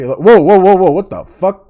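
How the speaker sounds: loud startled whoa; startled shout. Real, not acted. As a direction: jump out of your skin. Sudden change startles streamer.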